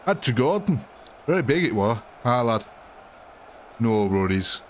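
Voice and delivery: hammed-up Yorkshire storyteller; broad Yorkshire, dropping the little words; Yorkshire accent. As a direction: play a reminiscing Yorkshire lad. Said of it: "They speak like this in Yorkshire".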